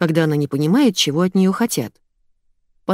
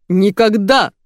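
short, higher-pitched bark; barked refusal; 3 semitones higher, 4 dB louder, slower than usual. A narrator's reading. Boss rejecting guard's plea, barking. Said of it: "рявкнул Илья".

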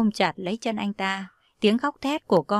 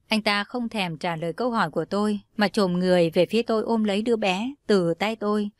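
plain reading voice

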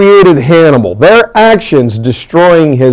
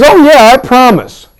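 mock promise; 8 semitones higher than usual. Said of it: "So he goes".

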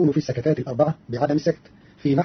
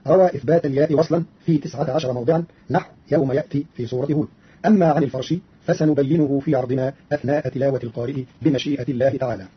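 ordinary talk, nothing marked

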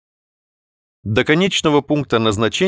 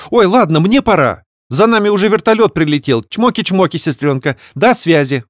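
high, loud chirpy goodbye; hurried breezy sister; 8 semitones higher, 4 dB louder than usual. Acted. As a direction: wrap it up breezily. Sister hangs up. (беззаботно, высоко)

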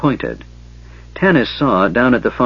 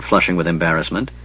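usual speaking voice

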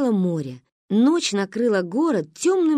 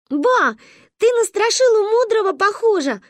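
much higher, louder cheeky blurt; blurted tease; 14 semitones higher, 6 dB louder than usual. Acted. Manka teases grandma about her hat, high and loud. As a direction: burst out with the tease. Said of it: "не вытерпела Маня".

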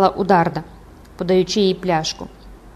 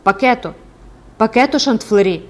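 louder call by name; 3 semitones higher, 5 dB louder than usual. Acted. Mahiette leaning to the window, calling out. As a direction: call someone through a small window. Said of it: "покликала".

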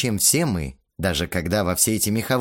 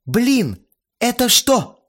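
higher, louder startled cry; surprised exclamation; 6 dB louder, slower than usual. A narrator's reading. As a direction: blurt it out in shock. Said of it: "удивлённо воскликнула Ирина".

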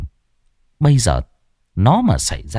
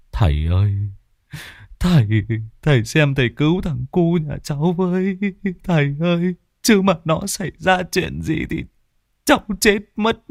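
high, frantic begging rising toward tears; desperate pleading; 7 semitones higher than usual. Acted. Father begs the shaman to save his missing son, frantic high pleading. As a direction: clutch at him and beg for your child.

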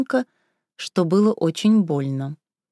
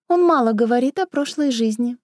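squeaky wife voice; 5 semitones higher than usual. A narrator's reading. Raya's jab, same high voice.

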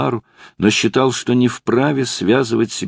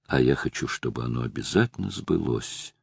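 про себя, тихо; 7 semitones lower, 9 dB quieter than usual. A narrator's reading. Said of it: "добавила она про себя".

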